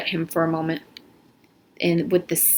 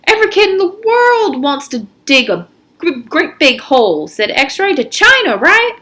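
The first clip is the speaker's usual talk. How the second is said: put-on high, loud, pushy teen; cocky teen, high and loud; X-Ray's voice; 8 semitones higher, 5 dB louder than usual. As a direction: push him, voice up high and loud. X-Ray voiced in story.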